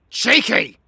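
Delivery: high, very loud call of a name; sharp shout. Acted. Demanding Cheeky look them in the eyes, sharp shout. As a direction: yell it to cut through their fit. Calling agitated partner to attention.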